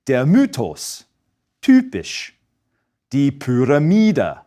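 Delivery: "Mythos", "typisch", "Pyramide" with the Y said as a rounded Ü; Y said like a German Ü, lips rounded. Shown as a demonstration. Teacher demoing Y said as U umlaut. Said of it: "pronounced like the U umlaut".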